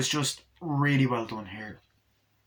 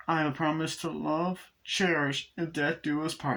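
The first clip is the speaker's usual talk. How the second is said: higher, slow mock-sweet recital; drawn-out imitation of a bride; doll's wedding vows; 4 semitones higher than usual.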